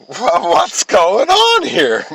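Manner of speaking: high, drawn-out fake puzzlement; mock-innocent slow confusion. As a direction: act innocent, stretching it slowly. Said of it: "And I'm like".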